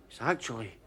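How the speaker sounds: soft, low, solemn reveal; hushed and solemn. Acted. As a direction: drop to a hush for effect. Solemn mock-religious pronouncement.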